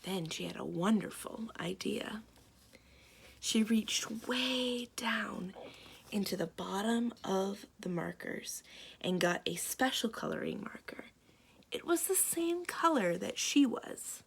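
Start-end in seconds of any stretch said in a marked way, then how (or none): 0.0-8.6 whispered. A narrator's reading. Storyteller letting kids in on a secret, whispered. Secret plan told hushed. 10.1-11.1 low hushed whisper. A narrator's reading. Storyteller revealing the special marker, low whisper.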